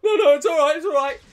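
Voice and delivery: imitating the bully's panicky retreat; scared backing off. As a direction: back down fast like a frightened kid. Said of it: "it's like".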